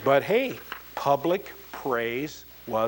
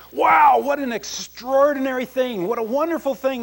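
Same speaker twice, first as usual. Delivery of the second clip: thrilled exclaim; 11 semitones higher, 9 dB louder than usual. Acted. Alien thrilled at the news.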